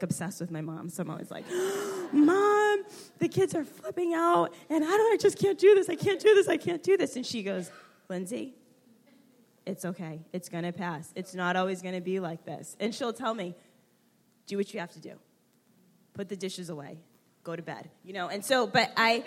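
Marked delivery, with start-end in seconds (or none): 1.5-7.2 high, loud mock meltdown to mom; hammed-up frantic wail with a gasp; panicked whining. Overwhelmed daughter phoning mom about kids, panicked. Retold panicked call to mother. 8.1-17.9 soft, steady imitation of mom; quietly soothe, then gently instruct; calm reassurance. Mom settling her panicking daughter, calm and reassuring. Mother's calm answer, retold.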